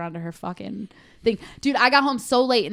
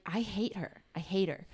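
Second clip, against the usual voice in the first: muttered hatred; 9 dB quieter than usual. Retold or imitated. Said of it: "and I was like".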